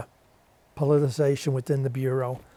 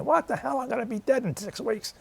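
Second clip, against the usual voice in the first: much higher gruff dismissive retort; defiant old Ray; 10 semitones higher than usual. Retold or imitated. Dying veteran waving off the shoveling friend, imitated defiant retort. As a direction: throw off the warning like a stubborn old sailor.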